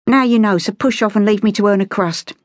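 put-on high-pitched street girl, dismissive; high, cheeky brush-off; defiant Cockney girl. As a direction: send him packing in a London street voice. Beggar girl dismisses the gentleman.

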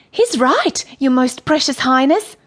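high, sweetly deferential character voice; flattering Courtney. A narrator's reading. Courtney joins in flattering queen.

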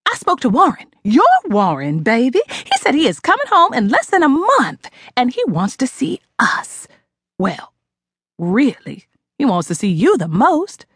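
mildly excited, rushing to share; excited mother. Acted. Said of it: "she said excitedly".

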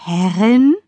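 puzzled question with a steep rise; baffled echo. A narrator's reading. Uncle puzzled by the title given his niece, baffled. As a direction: echo the word in disbelief. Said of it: "runzelte verwirrt die buschigen schwarzen Augenbrauen".

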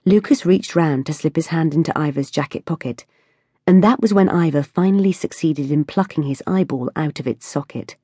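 ordinary delivery, nothing marked

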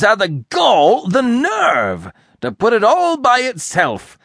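high, loud, indignant harangue; furious tirade. A narrator's reading. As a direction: rant in a sudden burst of fury. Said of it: "burst of fury".

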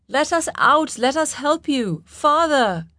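high, desperate cry for release; wailing plea. A narrator's reading. Trapped daughter calls up to her father, wailing. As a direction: plead at high pitch, rising to a wail. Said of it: "Her last word was a wail".

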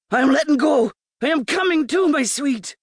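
high cry of passion; high, urgent rapture; ecstatic outcry. A narrator's reading. Voiced lover at climax.

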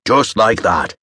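a hint of a hammy Irish pirate; lightly voiced pirate, pleased with himself; mild pirate swagger; piratey tone. A narrator's reading. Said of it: "dramatic and piratey tone".

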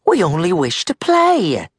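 Molly calling back. A narrator's reading. Molly answering Red's threat, calling back. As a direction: call it back lightly. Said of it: "Molly called back".

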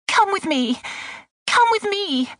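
high, frantic urging; panicked cry. A narrator's reading. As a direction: urge him along in high-pitched panic. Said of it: "the Mem Sahib cried".

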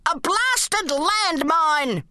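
put-on high, slow cursing old soldier; annoyed yell. A narrator's reading. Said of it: "he yelled".

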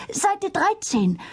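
out of breath, high voice; panting after running in; gasping for air. A narrator's reading. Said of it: "keuchte er".

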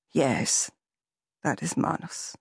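grave quiet recognition. Acted. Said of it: "said Andreas gravely".

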